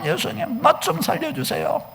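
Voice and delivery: small, imploring voice acting the beggar; hushed pleading. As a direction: beg quietly, voice dropped right down. Acted beggar's plea, suddenly hushed.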